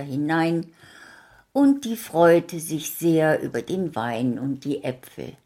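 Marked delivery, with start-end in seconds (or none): none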